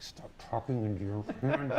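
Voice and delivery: laughing. Retold or imitated. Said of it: "Audio guys just, like".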